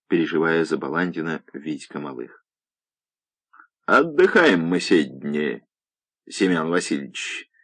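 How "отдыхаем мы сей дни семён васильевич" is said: relishing mockery. A narrator's reading. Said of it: "наслаждался Варфоломеев".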